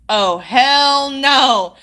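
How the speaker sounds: long, drawn-out retold refusal; emphatic refusal. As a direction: stretch each word out in dread-filled refusal. Reacting to the dreaded TV noise.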